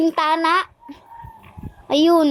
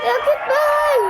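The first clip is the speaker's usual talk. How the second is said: much higher squeal of the call; high excited call; 10 semitones higher than usual. Pretend. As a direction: shriek it out in delight. Calling out a game move.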